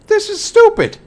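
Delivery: high, fed-up outburst; exasperated. Real, not acted. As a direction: throw it up in disgust. Exasperated at game politics.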